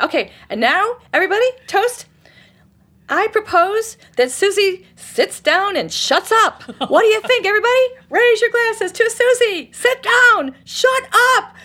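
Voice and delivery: rally the room to tell Susie off; mock toast shout. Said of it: "It was like, woo".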